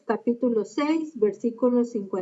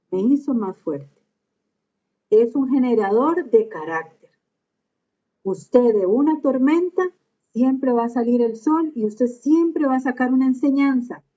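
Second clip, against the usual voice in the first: loud, emphatic preaching to the congregation; forceful preaching; 3 semitones higher, 6 dB louder than usual. Real, not acted. Preacher exhorting his congregation, loud and forceful. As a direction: proclaim it with full force from the pulpit.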